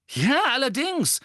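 high, loud emphatic confirmation; angry. A narrator's reading. Ron standing by Harry against Seamus, angry. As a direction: fire back an irate yes. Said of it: "sagte Ron zornig".

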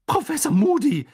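high, loud shocked cry; scandalised high exclamation; horrified. A narrator's reading. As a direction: call out the name, appalled. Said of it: "ertönte eine entsetzte Stimme".

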